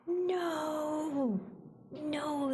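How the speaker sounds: high, shocked "No!"; dismayed cry. Real, not acted. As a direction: gasp then cry out in dismay. Sees AI tag, dismayed.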